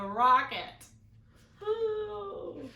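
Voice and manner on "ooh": surprised ooh. Real, not acted. Reacting to story peril.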